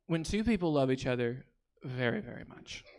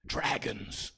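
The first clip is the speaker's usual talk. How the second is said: normal talking voice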